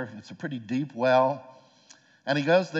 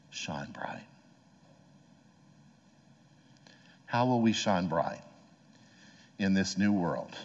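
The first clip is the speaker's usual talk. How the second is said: lower, measured delivery with long pauses; solemn sermon closing; 4 semitones lower than usual. Real, not acted. Preacher charging the congregation to shine, solemn closing.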